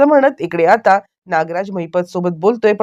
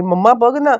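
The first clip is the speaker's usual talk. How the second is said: mock shouting; 7 semitones higher than usual. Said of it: "ओरडायला लागली आहे".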